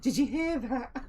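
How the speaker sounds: very high, loud taunt; skipping villainess. Acted. Masked woman skips over to her victim, high mock-playful taunting. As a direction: tease him in a high put-on voice. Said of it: "skips over to him and says".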